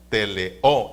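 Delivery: "Teleo" with a loud, rising final O; stress on last syllable. Shown as a demonstration. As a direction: punch the end of "Teleo": te-le-Ó. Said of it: "con acento en la A". ("Teleo" con la O final fuerte y acentuada)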